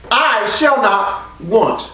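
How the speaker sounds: "I shall not want" slow, each word weighted; emphatic slow scripture. Shown as a demonstration. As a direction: lean on "shall not", slowly. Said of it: "That's a future perfect".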